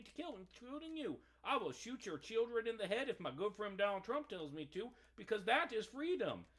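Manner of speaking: mock soldier. Mocking obedient soldier's voice.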